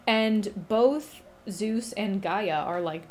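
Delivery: ordinary delivery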